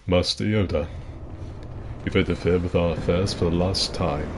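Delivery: lightly voiced screen villain; a hushed hint of a booming actor; restrained impression of a grand, menacing villain; Christopher Lee. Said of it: "You know, my Christopher Lee".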